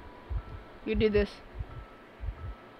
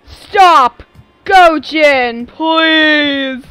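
loud, high outcry with the last word drawn out; pleading shout; 4 semitones higher, 11 dB louder, much slower than usual. Real, not acted. Streamer telling Gojin to stop, pleading shout. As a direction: call out loudly, begging them to stop. Scolding a raider playfully.

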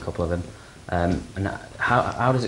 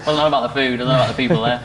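chuckling through the words, a bit higher and louder; laughing; 4 semitones higher, 4 dB louder, much faster than usual. Real, not acted. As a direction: laugh it out as you tease. Joking about the dinner food.

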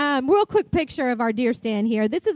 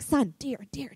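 softly, so the deer won't hear; hushed hunting whisper; 7 dB quieter than usual. Retold or imitated. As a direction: hush it to your son in the deer stand. Spotting a deer from the stand.

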